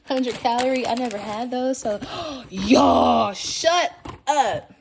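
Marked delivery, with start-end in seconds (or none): 2.2-4.7 real disbelief bursting out; squealing excitement at the gift; shocked thrill. Real, not acted. Thrilled at gifts in bag.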